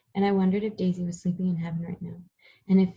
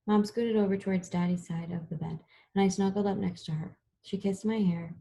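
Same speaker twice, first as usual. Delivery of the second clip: ordinary delivery, nothing marked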